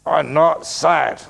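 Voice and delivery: slow, stubborn denial, leaning on NOT; insistent denial. Pretend. Monk mimicking someone denying their sadness, slow and sulky. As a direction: drag out I'm not sad like a sulky child.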